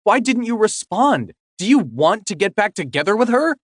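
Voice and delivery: put-on high, agitated girlfriend; upset confrontation. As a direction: demand answers, hurt and angry.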